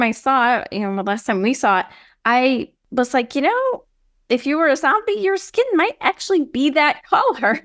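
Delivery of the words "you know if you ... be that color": high mock musing. Retelling own musing thought.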